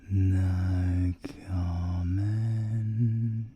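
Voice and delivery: very slow low drawl; very slow no comment. Acted. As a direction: stretch it out lazily to mock. Stonewalling with varied repeats.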